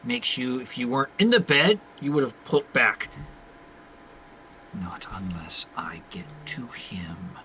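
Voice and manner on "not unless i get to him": low, slow, hushed threat; menacing whisper. Acted. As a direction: draw it out in a dark whisper. Character's menacing reply, fire in eye.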